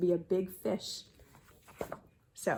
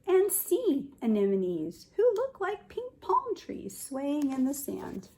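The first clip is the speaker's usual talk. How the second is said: higher, lilting read-aloud; storybook narration; 7 semitones higher, 4 dB louder, slower than usual. A narrator's reading. Teacher reading about sea anemones to children, higher and lilting. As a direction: paint the picture brightly for the kids.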